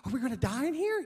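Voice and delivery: high, fast panicked question. Retold or imitated. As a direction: blurt it out in a rush of fear. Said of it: "I'm like".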